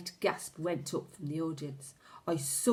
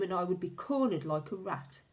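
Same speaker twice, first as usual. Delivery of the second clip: ordinary delivery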